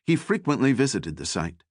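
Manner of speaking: ordinary delivery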